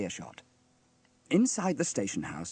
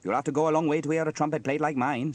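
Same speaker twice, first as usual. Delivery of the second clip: higher, louder, quick proud boast; boastful; 5 semitones higher, 6 dB louder, faster than usual. A narrator's reading. Sam boasts of his trumpet playing, proud and brisk. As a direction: brag away in a raised character voice.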